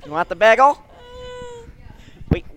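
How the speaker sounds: high, loud fussing relative; family member impression. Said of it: "This is everyone in my family".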